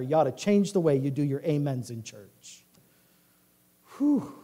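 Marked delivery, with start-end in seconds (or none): none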